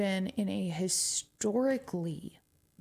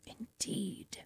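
soft whispered agreement; hushed knowing "Indeed"; 8 dB quieter than usual. Real, not acted. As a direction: breathe it out as a whisper. Repeats indeed.

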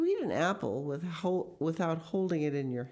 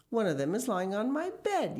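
put-on high-pitched child's protest; aggrieved little boy; indignant yell; 7 semitones higher than usual. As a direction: yell it at a moderate volume, pitch raised. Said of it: "yelled William".

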